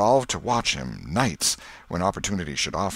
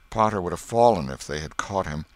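usual speaking voice

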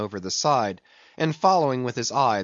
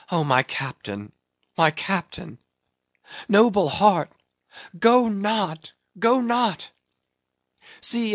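anguished high-pitched entreaty, rising from a subdued start; tearful pleading; 6 semitones higher than usual. A narrator's reading. Mate begs his captain to go not, tearful pleading. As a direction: plead desperately, voice climbing high. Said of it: "Starbuck's tears the glue".

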